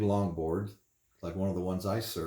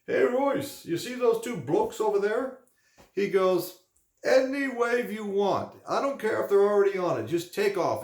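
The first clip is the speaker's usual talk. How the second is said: put-on much higher, loud joking old-timer voice; teasing old surfer; 11 semitones higher, 4 dB louder than usual. Old Colonel teasing Royce about two blokes, in a much higher loud jokey voice. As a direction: ham up a cheeky old local egging him on.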